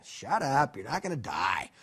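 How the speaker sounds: low, dry hushing of a panicker; unimpressed, calming put-down; curt reassurance. Acted. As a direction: drop the pitch and settle him down. God settles the panicking Gideon.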